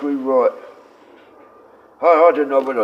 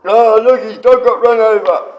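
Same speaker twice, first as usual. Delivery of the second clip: loud, high-pitched mock gloating; mimicked jeering; 8 semitones higher, 8 dB louder than usual. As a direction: call it out loudly, imitating jeering onlookers. Mimicking the laughing group's jeer.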